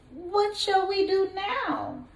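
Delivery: put-on high, small young goat asking; little goat voice. Storyteller voicing the little goat asking his brothers. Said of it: "Little Billy Goat Gruff asked".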